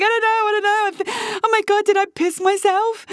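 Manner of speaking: high, flustered panic, pitched well up; retold panic. Retelling her own panic to listeners, flustered and high. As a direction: replay the alarm of a first surprise. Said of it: "I was like".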